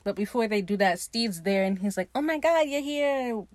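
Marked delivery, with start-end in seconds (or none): 2.1-3.4 high, delighted greeting; mock excitement. Steed greeting Ed before his big finale, mock excitement. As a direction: imitate a thrilled host, pitched up.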